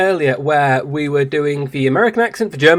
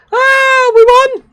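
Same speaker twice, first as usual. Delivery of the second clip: very high, loud victory cheer; shrill cartoon girl celebrating; high squeaky anime girl; 11 dB louder, much slower than usual. Winning the battle, very high squeaky girl cheer.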